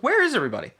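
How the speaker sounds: high, puzzled call out. Retold or imitated. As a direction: wonder aloud where everyone went. Retold confusion, nobody there.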